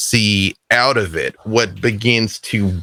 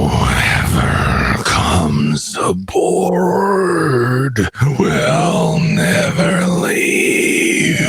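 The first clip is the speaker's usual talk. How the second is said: very slow, drawn-out groaning wail; monstrous ship's voice; 4 semitones higher, much slower than usual. Acted. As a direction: boom it like a haunted ship, stretching every word. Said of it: "even louder with this voice".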